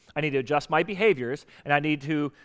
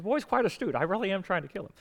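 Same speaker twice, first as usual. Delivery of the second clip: badly faked villain accent, softer; Doctor Evil; 2 semitones higher, 6 dB quieter than usual. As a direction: do a hammy comic villain, quietly confiding. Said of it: "That's a horrible Doctor Evil accent".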